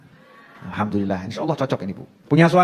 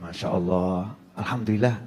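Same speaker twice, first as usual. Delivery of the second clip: low, hushed greeting; soft-spoken retold exchange; 11 semitones lower, 8 dB quieter than usual. Wife asking husband about his work, soft-spoken. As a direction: speak low and softly, as if keeping the peace.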